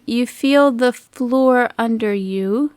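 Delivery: ordinary delivery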